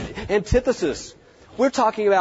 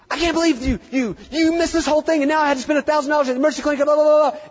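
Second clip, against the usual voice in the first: higher, fast, rising anger; angry client rant; 11 semitones higher, 4 dB louder, faster than usual. Retold or imitated. Client berating the vet over a costly emergency, angry. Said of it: "people are angry".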